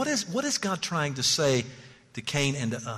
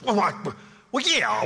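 hesitant mock reply; 6 semitones higher than usual. Preacher imitating the flustered man, stumbling over yeah. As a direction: play the man caught off guard.

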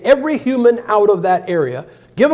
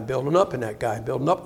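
repeated softer, dropping lower; fading echo; 5 semitones lower, 8 dB quieter than usual. Shown as a demonstration. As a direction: let the repeat sink away quietly.

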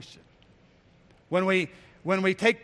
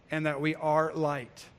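usual speaking voice

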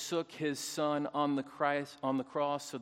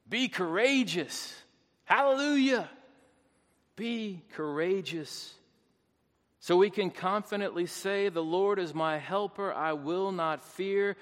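exultant preaching; 5 semitones higher, 6 dB louder than usual. Real, not acted. Preacher giving the final command to be courageous, exultant preaching. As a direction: ring it out from the pulpit, peaking on Hallelujah.

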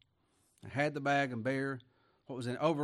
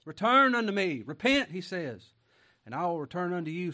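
loud, higher fervent plea; fervent call; 3 semitones higher, 9 dB louder than usual. Acted. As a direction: cry it out to the congregation. Preaching scripture at full force.

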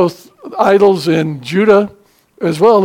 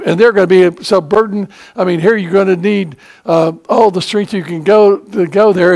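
preaching hard; 3 semitones higher, 3 dB louder than usual. Acted. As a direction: drive the warning home from the pulpit. Rising sermon warning.

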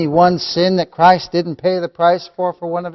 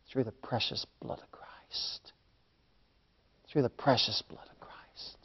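near-whispered reverence; hushed preaching; 4 semitones lower, 16 dB quieter than usual. Real, not acted. Preacher dwelling on the precious blood of Christ, hushed near-whisper. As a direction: let the voice fall away almost to breath.

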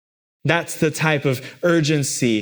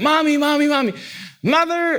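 repeated calling; 13 semitones higher than usual. Retold or imitated. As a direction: keep calling mom over and over. Child repeating calls to mom.

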